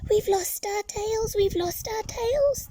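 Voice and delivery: high, excited cheering tadpoles. Acted. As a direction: cheer it out in little high voices. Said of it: "they cheered".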